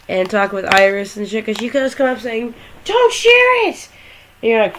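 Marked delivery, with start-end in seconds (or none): 2.8-3.9 very high, drawn-out cry; stretched high-pitched pleading; mock frantic plea. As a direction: squeal it out as an urgent interruption. Voicing Iris butting in.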